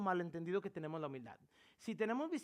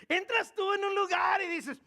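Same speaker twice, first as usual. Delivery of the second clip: loud; 14 semitones higher, 11 dB louder than usual. Real, not acted. Preacher mocking proud entrance.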